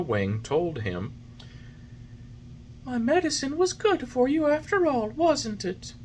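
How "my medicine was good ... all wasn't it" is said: high, gentle fairy voice; fairy, patient. A narrator's reading. Fairy patiently coaxing the puppet. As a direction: soothe him kindly.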